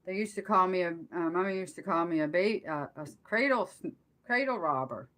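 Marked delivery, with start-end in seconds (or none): none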